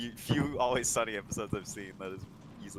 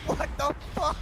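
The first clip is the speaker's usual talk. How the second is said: much higher, put-on shocked cry; horrified outburst; 14 semitones higher, 4 dB louder than usual. Reacting to the dog in the mac, high horrified cry. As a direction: recoil in disgust. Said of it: "And he's like".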